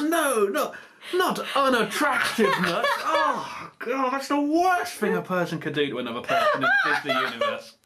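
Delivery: high-pitched, hammed-up wailing protest; mock horror. Prince hearing he'll be made unattractive, mock-horrified wail. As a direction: wail it in comic despair.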